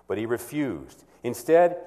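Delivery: normal talking voice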